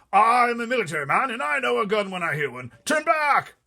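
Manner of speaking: high, loud frightened cry; a blustering general in a panic; terrified army chief. Acted. Army head crying out from the back seat, terrified.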